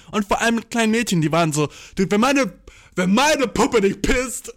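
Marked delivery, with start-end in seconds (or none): 3.0-4.5 laughing through the words. Retold or imitated. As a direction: giggle through the quote. Laughing while quoting girls.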